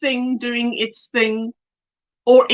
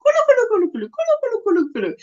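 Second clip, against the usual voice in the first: high, quick kulu kulu gobble; high mock gobbling of ladies at tea; turkey chatter imitation; 8 semitones higher, much faster than usual. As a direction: cackle like turkeys at a tea party. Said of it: "That's how it sounds".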